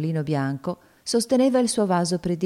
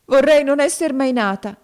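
put-on higher, louder sobbing girl; grieving cry; 10 semitones higher, 7 dB louder than usual. A narrator's reading. Topsy grieving for Eva, sobbing.

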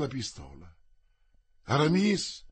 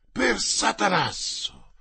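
Gascon oath, slow and higher; a Gascon swearing in surprise; Gascon accent; 5 semitones higher, slower than usual. Acted. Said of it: "con il suo accento guascone".

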